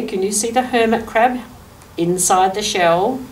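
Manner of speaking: plain reading voice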